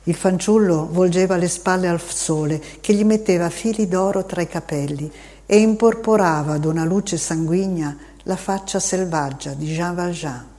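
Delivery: plain reading voice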